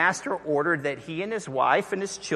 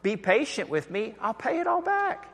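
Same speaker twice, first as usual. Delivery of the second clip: begging servant; 7 semitones higher than usual. Retold or imitated. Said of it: "he begged".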